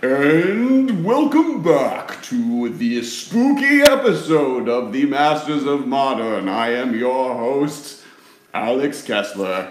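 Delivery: slow, drawn-out spooky host voice. Acted. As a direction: welcome them like a haunted-house host. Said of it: "I was in a spooky voice".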